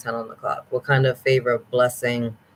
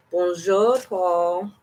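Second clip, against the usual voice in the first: French greeting; 6 semitones higher, much slower than usual. Real, not acted. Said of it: "I don't speak a lot of French".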